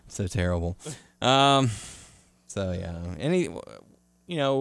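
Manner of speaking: normal talking voice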